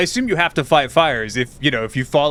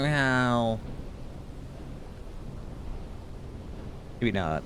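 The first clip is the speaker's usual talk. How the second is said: sarcastic; 11 dB quieter than usual. Pretend. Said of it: "really patronizing and sarcastic".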